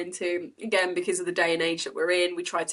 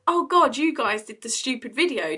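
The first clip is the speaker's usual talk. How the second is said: excited; 5 semitones higher, 3 dB louder than usual. Retold or imitated. Imitating excited fans.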